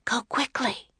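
high, soft urgent whisper; high whispery girl's voice. A narrator's reading. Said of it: "whispered the girl".

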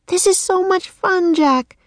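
put-on high, gleeful bird-girl voice; croaking. A narrator's reading. Annie enjoying flying with Jack, high croaking voice. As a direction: chirp it high like a raven having fun. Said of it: "she croaked".

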